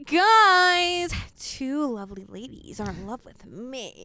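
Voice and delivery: mock grand announcement, loud drawn-out GUYS; smug boy imitation. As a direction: crow it slowly like a self-satisfied schoolboy. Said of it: "Is that how he said it?".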